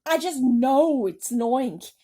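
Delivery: loud and high, panicky insistence; screaming. A narrator's reading. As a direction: scream it, certain and terrified. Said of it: "screamed the head of the fire department".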